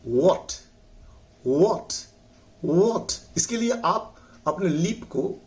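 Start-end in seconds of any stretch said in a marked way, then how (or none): 0.1-3.1 what with rounded lips, a rising pitch. Shown as a demonstration. Teacher repeating the correct what for students. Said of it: "इसकी उच्चारण है what".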